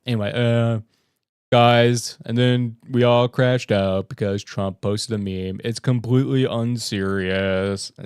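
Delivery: lightly voiced droning monotone; Ben Stein impression. Mocking Jack as Ben Stein, dull low drone. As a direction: drone it out like a bored lecturer. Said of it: "What does Jack sound like".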